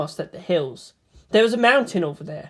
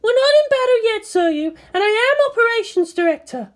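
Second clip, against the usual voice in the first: high, louder woman's voice putting her in place; firm officer's retort; 4 dB louder than usual. Acted. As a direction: pull rank on the pilot. Misato retorts.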